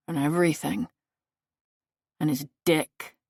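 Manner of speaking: ordinary delivery, nothing marked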